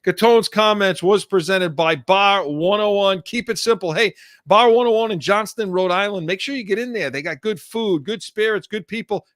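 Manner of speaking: upbeat radio sponsor read. Acted. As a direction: sell the bar like a DJ.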